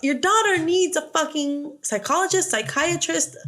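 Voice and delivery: exasperated mother. Retold or imitated. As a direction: vent like a fed-up mother listing every specialist. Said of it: "that's what she's like".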